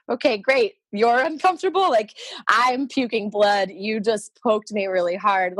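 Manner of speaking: exasperated sarcasm, weighing out the later words; sarcastic. Retold or imitated. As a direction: throw it back with retold indignation. Retold sarcastic comeback to doctor.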